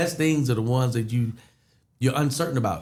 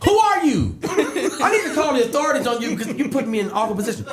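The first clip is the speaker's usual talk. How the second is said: laughing panic. Retold or imitated. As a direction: half-shout it through laughter, flustered. Retold panic over surprise contest.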